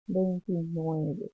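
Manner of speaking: ordinary talk, nothing marked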